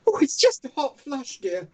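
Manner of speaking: put-on high, flustered elderly nanny; hammed-up high old-lady voice; Mrs Doubtfire voice. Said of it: "removing the beard".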